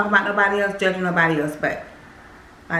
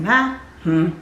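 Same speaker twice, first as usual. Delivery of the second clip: usual speaking voice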